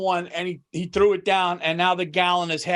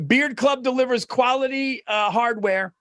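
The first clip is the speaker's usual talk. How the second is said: ad read; 6 semitones higher than usual. Acted. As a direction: sell the beard product to the listeners. Reads sponsor ad.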